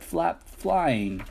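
usual speaking voice

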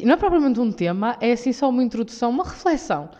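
ordinary talk

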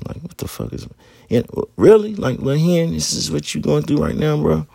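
high, pleading self-talk; inner voice to younger self. Retold or imitated. Older [name] asking young [name], voice pitched high. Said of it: "that's what this [name] is saying to that [name]".

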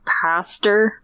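"pastor" with an R on the end for pasta; added final R. Retold or imitated. Friend showing how Brits say pasta, as "pastor" with R. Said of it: "they use an R".

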